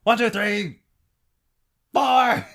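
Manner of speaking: mock-outraged countdown ending in a shout; furious countdown. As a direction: threaten him, then scream the count. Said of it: "I love that scene so much".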